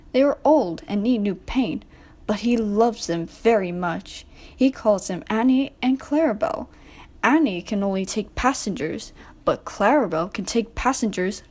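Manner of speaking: plain reading voice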